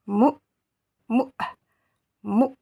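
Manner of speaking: "Mou" articulé du fond de la gorge; vowel from the back of the throat. Shown as a demonstration. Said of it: "comme si vous aviez un E, mais du fond de la gorge".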